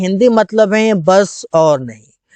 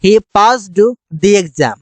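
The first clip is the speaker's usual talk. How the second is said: very slow, each word spelled out for learners; slow clear English; much slower than usual. Shown as a demonstration. Teacher slowly reading the English translation. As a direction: model the sentence slowly for students. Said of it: "उसने परीक्षा पास किया।".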